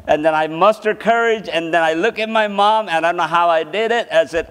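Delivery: ordinary delivery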